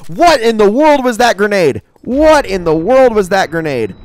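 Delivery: high, loud disbelief; real shocked outcry; astonished outburst. Real, not acted. Amazed reaction to a grenade in game.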